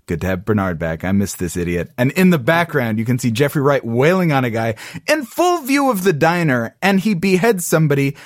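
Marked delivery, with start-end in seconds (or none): 0.0-1.9 lower, mumbled mock Stubbs chewing a sandwich; a hint of Stubbs, low and offhand. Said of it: "just being like".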